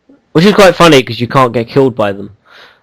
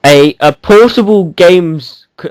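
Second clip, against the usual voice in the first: ordinary talk, nothing marked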